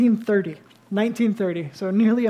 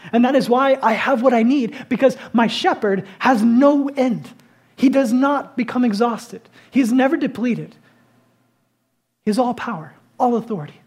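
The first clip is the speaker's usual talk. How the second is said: higher and louder, declaring God's greatness; fervent preaching; 4 semitones higher, 4 dB louder than usual. Acted. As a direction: proclaim it with rising force. Preacher climbs on shepherd's power.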